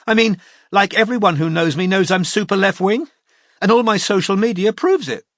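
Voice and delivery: high, loud, defiant insisting; desperate defiance. A narrator's reading. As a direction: argue back desperately. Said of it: "a kind of desperate defiance".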